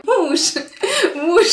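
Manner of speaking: mock-sweet call to the husband with a laugh; playful calling. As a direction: call out to her husband teasingly, laughing mid-call. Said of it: "я каждый день говорю".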